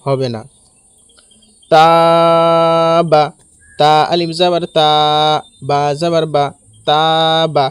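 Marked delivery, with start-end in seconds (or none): 1.7-7.6 তা drawn out long, বা short; one-alif elongation. Shown as a demonstration. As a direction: hold তা in তাবা on a level tone, then brief বা. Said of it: "এখানে টান দিতে হবে এক আলিফ পরিমাণ".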